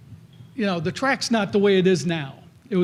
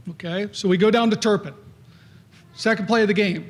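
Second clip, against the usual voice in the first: normal talking voice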